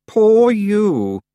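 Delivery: loud, fake pity; insincere. A narrator's reading. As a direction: pretend to be sorry. Anagrama, insincere.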